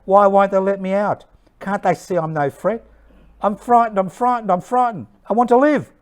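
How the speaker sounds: high, rushed, desperate plea; frightened pleading. Acted. Internee begging to be let out and go home, frightened pleading.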